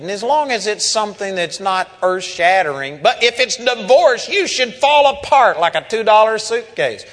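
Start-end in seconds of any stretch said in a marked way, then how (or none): 3.0-7.1 louder and higher sermon build; rising preacher's fervor; preaching at full force. Acted. Preacher mocking Christians' view of divorce, loud and fervent.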